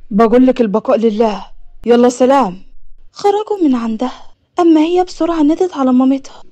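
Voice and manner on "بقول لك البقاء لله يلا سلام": low, loud, brusque man's voice; acted impatient messenger of death; curt dismissal. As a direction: repeat the condolence firmly and be done. Man curtly repeats news, leaves.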